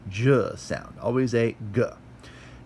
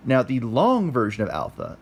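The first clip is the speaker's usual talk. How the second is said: ordinary talk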